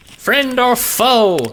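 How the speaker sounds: high, slow, squeaky challenge; squeaky demand. A narrator's reading. Frightened little man challenging strangers, squeaky. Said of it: "he demands in a squeaky voice".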